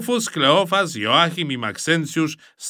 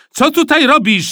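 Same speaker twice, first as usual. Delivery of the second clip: loud, high demanding question; sharp challenge; 9 dB louder than usual. A narrator's reading. Supervisor confronting Kleofas mid-fight, demanding loudly.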